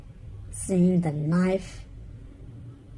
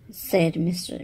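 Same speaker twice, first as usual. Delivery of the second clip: usual speaking voice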